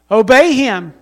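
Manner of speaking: usual speaking voice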